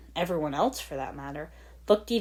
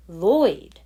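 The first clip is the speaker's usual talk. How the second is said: exclaiming; 2 semitones higher, 10 dB louder than usual. Acted. Realisation about name.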